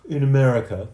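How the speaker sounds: plain reading voice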